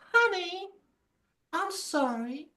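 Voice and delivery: high, soft mock apology; put-on sorry voice. Playing a double life for laughs, high apologetic voice. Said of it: "That voice, though".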